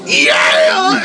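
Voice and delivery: mock yelled yeah; shouted yeah. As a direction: shout it back at the coach. Umpire shouting at coach.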